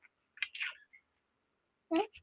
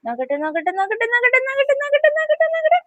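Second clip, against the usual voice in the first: climbing higher with each repeat; 11 semitones higher than usual. Real, not acted. Mother teasing her baby, climbing to a squeal. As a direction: build up the tease to a squeal.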